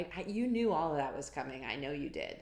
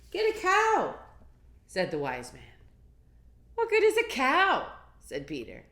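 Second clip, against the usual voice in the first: put-on lively read-aloud to kids; picture book reading; 5 semitones higher, 9 dB louder than usual. A narrator's reading. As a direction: read it big and bouncy for children. Reading the story on.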